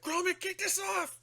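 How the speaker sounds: high, strained cry for help; Wallace panicking. Retold or imitated. Wallace yelling to Gromit to pull the helmet off, high panicked cry. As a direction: yelp it like a panicked inventor. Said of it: "It's like".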